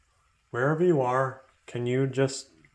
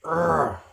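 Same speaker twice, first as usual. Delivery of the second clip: frustrated groan; 6 semitones higher, 7 dB louder than usual. Retold or imitated. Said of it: "but just like".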